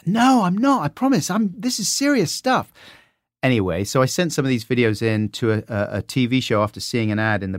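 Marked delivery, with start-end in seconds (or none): 0.1-2.7 much higher pleading student voice; defending himself to the art tutor, pleading; young art student. Retold or imitated. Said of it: "Said one tutor".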